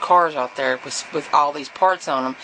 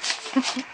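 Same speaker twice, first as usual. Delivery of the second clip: soft giggle; 9 semitones higher, 5 dB quieter than usual. Real, not acted. After teasing her friend.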